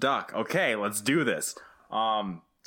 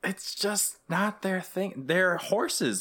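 mock condescension; 9 semitones higher, slower than usual. Mock lecturing about horses.

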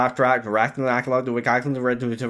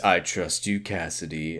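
low and hushed, falling away; sinister murmur; ominous quiet line; 9 semitones lower, 9 dB quieter than usual. Retold or imitated. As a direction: say it under your breath, menacing. Quoting Kyle's sinister closing line.

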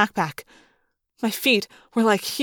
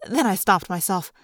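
plain reading voice

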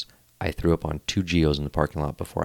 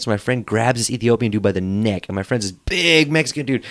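usual speaking voice

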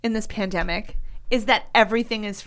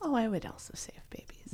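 soft conspiratorial whisper; 3 semitones higher, 14 dB quieter than usual. Real, not acted. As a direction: breathe it as a secret. Whispered reply in the joke.

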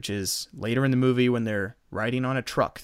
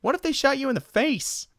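much higher silly voice; goofy worried question; 12 semitones higher than usual. Retold or imitated. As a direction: ask it like a dim worried friend. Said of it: "First thing Jim Carrey says".

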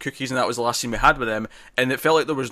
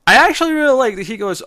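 excited; 11 semitones higher, 7 dB louder than usual. Real, not acted. Excited praise of scene.

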